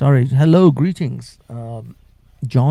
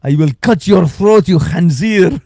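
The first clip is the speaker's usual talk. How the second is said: slow, louder mock Middle Eastern accent; Uncle Omar's insult; 3 semitones higher, 5 dB louder, slower than usual. Uncle Omar calling the host a pig at the park, in accent. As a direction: spit it out in a thick put-on Middle Eastern accent. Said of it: "This is what Uncle Omar calls me".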